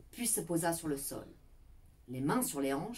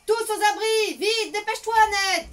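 high, loud alarmed shout; panicked warning cry; knights shouting; 12 dB louder than usual. A narrator's reading. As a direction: raise the alarm, urging Ned to hurry. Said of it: "Les chevaliers crièrent".

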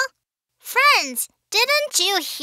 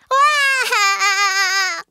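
put-on childish crying. Baby shark who can't find Daddy, bawling. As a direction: burst into a drawn-out wail. Child cries over missing father.

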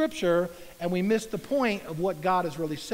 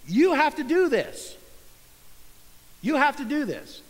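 higher, louder, insistent order; stern demand; 6 semitones higher, 4 dB louder than usual. Pretend. Jesus imagined as a stern taskmaster, insistent. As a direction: bark it like a demanding boss. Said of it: "Is he telling you".

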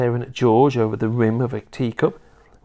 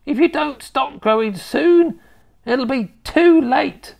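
put-on scolding old grandma; 4 dB louder than usual. Grandma telling off her growing grandson, scolding.

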